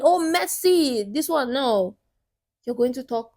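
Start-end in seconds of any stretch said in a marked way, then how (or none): 0.0-1.5 higher-pitched, slow, mild mock yell at the TV; lightly imitating a fan shouting at a match; mock football yell. Coach mocking how men sound watching football, mild mock yell. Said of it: "when you're screaming".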